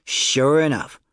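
ordinary delivery, nothing marked

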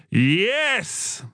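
high triumphant cry without much volume; lightly voiced kid's shout. A narrator's reading. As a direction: cry out in victory. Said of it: "Joel shouted above the twins' taunts".

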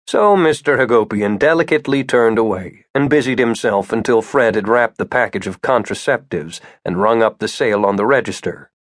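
ordinary delivery, nothing marked

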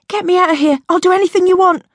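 high, frantic, pleading cry; desperate plea. A narrator's reading. Angel fleeing the women, pleading with the driver in panic. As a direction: beg to be rescued, panicking.

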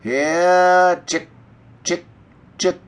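farmer calling in the hens; long "here", then short separate chicks; calling chickens. A narrator's reading. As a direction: call the birds to feed. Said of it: "and calling".

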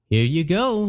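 the genie pointing out a coin; genie Patrick. Acted. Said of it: "he said".

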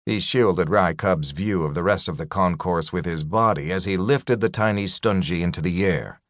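usual speaking voice

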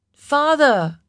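high, loud cry for help; calling out. A narrator's reading. Trapped daughter calls to the king, crying out. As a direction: call up loudly to someone far above. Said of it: "Lin called out".

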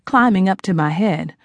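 usual speaking voice

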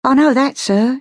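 put-on high, deferential Cockney girl; humble street-girl voice, pitched high. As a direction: answer humbly, as to a social superior. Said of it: "she said humbly".

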